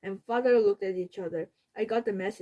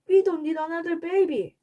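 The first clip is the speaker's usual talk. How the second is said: protesting; 8 semitones higher, 5 dB louder than usual. A narrator's reading. Peter protests to Dad.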